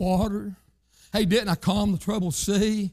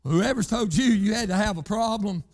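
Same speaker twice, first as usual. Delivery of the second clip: preaching; 4 semitones higher than usual. Acted. Preaching run.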